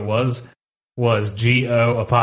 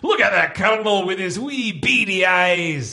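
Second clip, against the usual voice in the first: Scottish rant quote; 6 semitones higher than usual. Retold or imitated. Quoting the Colonel Sanders rant.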